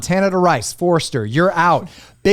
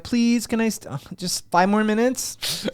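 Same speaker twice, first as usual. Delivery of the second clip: mock plea; 7 semitones higher, 4 dB quieter than usual.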